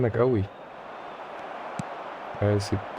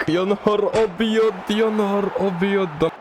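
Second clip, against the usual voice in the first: دهشة مبالغ فيها; 9 semitones higher than usual. Real, not acted. Shocked by a play in the match.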